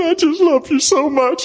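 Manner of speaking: high, choked-up declaration of love; high tearful gush; retold weeping. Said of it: "and I'm just crying".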